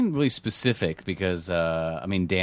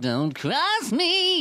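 not really angry, high slow warning; comical threat; much slower than usual. Shown as a demonstration. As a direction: stretch out a threat in a silly high voice. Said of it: "It's almost comical".

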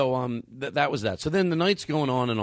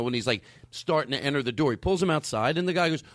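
ordinary talk, nothing marked

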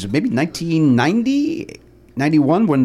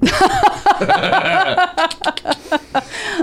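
high, long buzzing insect drone; cicada buzz. Retold or imitated. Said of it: "He's been sitting on that cicada impression".

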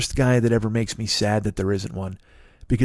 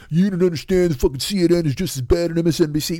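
mock pundit fan; 3 semitones higher than usual. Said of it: "everybody's like".

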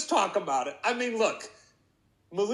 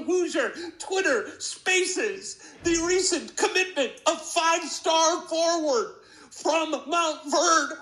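ringmaster; 7 semitones higher than usual. Acted. Circus-style intro to Malik Mania.

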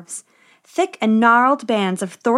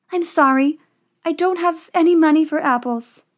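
higher, sorry princess voice; 6 semitones higher than usual. A narrator's reading. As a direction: apologize sweetly, a little embarrassed. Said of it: "she said".

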